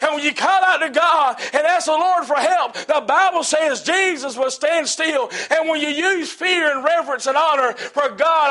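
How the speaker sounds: loud, high, driving sermon delivery; full-force preaching. Real, not acted. Preacher telling a congregation of blind Bartimaeus, full-force preaching. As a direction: preach it high and hard in rhythmic bursts.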